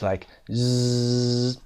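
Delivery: ز held with throat vibration; buzzed z sound. Shown as a demonstration. Teacher lets students feel the buzz of ز. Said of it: "The vocal cords are vibrating".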